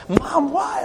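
high-pitched mock wail; preacher imitating his younger self over a popped chicken pox; retold childhood scream. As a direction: squeal it like an upset kid. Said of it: "I was screaming".

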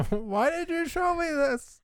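very high, whiny mock sob; nearly crying. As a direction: squeal it like you're about to cry. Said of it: "in, like, a nearly crying voice goes".

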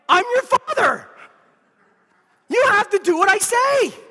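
loud, stern dad laying down the law; a father putting his foot down with his kids. Acted. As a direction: scold like an exasperated father. Father scolding his disobedient kids.

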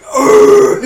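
loud, high guttural "urgh"; imitated heartburn groan. Bassist acting out heartburn during the gig, loud pained groan. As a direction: groan loudly like fire is coming up your throat.

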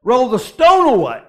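loud proclamation; commanding call. Retold or imitated. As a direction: call it out like Jesus at the tomb. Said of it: "and he said".

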